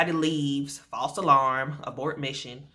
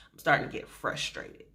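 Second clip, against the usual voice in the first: strained whisper; 3 semitones lower, 6 dB quieter than usual. Retold or imitated. Telling husband in pain.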